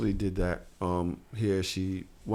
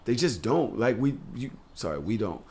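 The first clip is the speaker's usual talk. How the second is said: usual speaking voice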